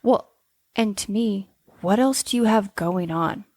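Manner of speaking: ordinary talk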